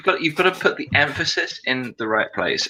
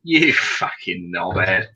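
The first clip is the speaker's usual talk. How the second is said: slow, low British "You fucking knobhead" with a shouted edge; a Brit showing an American how to say "You fucking knobhead"; proper British insult; 7 semitones lower, much slower than usual. Shown as a demonstration. Brit to American friend, slow mock-shouted British insult. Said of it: "For example".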